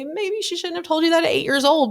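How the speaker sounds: high-pitched voice of a concerned critic; gently disapproving. Retold or imitated. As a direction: imitate someone politely second-guessing. Voicing critics of the mother.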